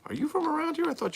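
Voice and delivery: very high, loud, stretched-out question; amazed repeat. Real, not acted. Host asks the caller again, in high amazed disbelief.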